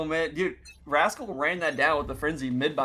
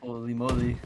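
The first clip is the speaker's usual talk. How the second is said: ordinary delivery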